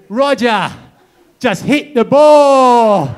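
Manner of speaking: loud, high yell with a long drawn-out ending; full-volume spectator's bellow, stretched out; acted heckling shout. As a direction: holler it from the stands at a player. Spectator yelling at Federer, punchline.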